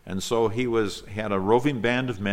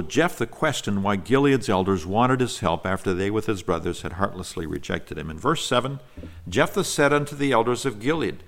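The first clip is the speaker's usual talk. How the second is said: ordinary talk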